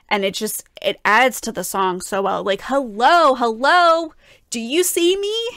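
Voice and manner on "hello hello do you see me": higher, louder, begging to be noticed; pleading. Retold or imitated. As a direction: plead with someone who won't look at you. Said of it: "There's a lot more pleading in this section".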